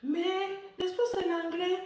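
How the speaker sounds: mock surprise. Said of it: "les gens qui me répondaient genre".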